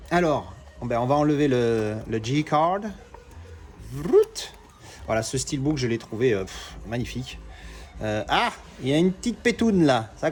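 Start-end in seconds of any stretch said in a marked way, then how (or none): 3.9-4.5 quick, high "vrrt" sound effect; imitated ripping noise. Real, not acted. Pulling the card off the steelbook, imitated rip sound. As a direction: roll a short noise that leaps up.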